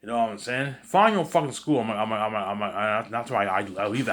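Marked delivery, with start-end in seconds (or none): 0.8-1.8 high, loud repeat of the father; echoed yell. Retold or imitated. Narrator echoing the father's order, raised voice. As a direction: yell it again, a little less.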